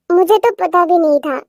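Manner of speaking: ordinary talk, nothing marked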